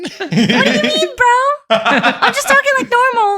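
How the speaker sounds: high, laughing protest. Real, not acted. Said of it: "Does it sound better now?".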